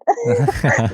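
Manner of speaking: a high burst of laughter. Real, not acted. Telling a friend about mom's reaction, laughing. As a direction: laugh at the memory.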